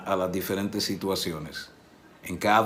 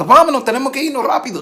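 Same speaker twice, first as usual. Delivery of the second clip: much higher, louder, fast alarm; hurried urgency; 9 dB louder, faster than usual. Pretend. Us hearing Lázaro is sick, hurried and alarmed. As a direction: rush everyone out the door. Imagined hurried disciple reaction.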